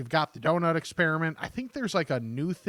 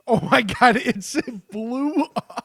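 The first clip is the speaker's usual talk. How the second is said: laughing; 7 semitones higher, 6 dB louder, slower than usual. Real, not acted. Laughing about Twitter blowup.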